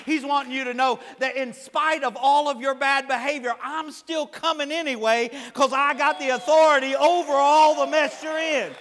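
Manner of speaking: building to loud, high preaching; full-force preaching. Acted. Preacher voicing God's authority to the congregation, full-force preaching.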